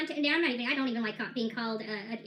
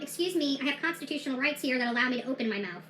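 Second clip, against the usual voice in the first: normal talking voice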